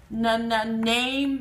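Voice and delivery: "na na name" with the n sound said twice before the word; first sound repeated. Shown as a demonstration. Teacher stressing the starting n, "na na name". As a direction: tap out "na na", then "name". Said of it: "The na is at the beginning".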